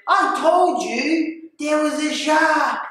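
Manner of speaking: high, slow, emphatic insistence; retold indignant protest. Diver to doubting friends on the boat, high indignant protest. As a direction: drag out the words to make them listen.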